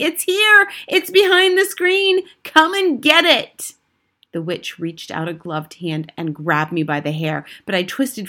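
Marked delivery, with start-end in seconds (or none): 0.0-3.7 high, loud gloating witch's yell; triumphant yell. A narrator's reading. Witch spots the boy behind the screen, triumphant yell. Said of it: "yelled triumphantly".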